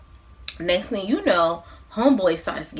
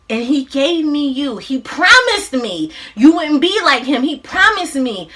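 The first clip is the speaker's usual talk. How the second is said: high, raised-voice acted confession; anguished pleading; 5 semitones higher, 5 dB louder than usual. Mother telling her son of the broken promise, acted anguish. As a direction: plead it with pained insistence.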